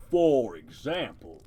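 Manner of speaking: slow, loud, deep creature voice. Acted. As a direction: boom it out slowly. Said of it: "give it a deep voice".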